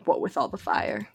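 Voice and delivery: soft unvoiced repeat; whispered echo. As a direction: whisper it back teasingly. Echoes partner's odd phrase.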